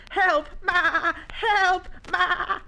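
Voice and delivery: high bleating cry for help; panicked shouting. Acted. Young llama flees the lions calling Ma, panicked shout. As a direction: bleat loudly for mother in alarm.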